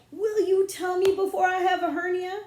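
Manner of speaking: loud, high frustrated demand; about to burst with impatience; exasperated outburst. Acted.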